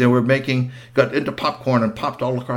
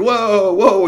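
alarmed whoa; 10 semitones higher, 6 dB louder than usual. Acted. Pulling back on the reins.

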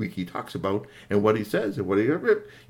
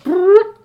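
high, loud buzzing robot-arm noise; mechanical whirr; 13 dB louder than usual. Acted. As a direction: make the arm zip out with a loud brrr.

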